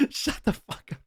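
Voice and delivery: cracking up at a silly joke; laughing disbelief. Real, not acted. Streamer laughing at a chat joke, giggly disbelief.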